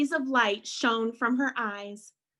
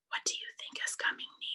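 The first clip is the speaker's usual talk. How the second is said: whispering; 8 dB quieter, much faster than usual. Real, not acted. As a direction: lean in and whisper the question. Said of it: "asks children in whisper".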